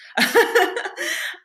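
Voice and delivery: high, loud burst of laughter; real laugh. Real, not acted. Guest hoping her friends won't watch, laughing out loud. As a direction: let a big high laugh out.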